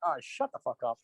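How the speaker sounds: quiet fed-up mutter; muttered dismissal. Retold or imitated. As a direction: mutter it under your breath. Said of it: "you're like".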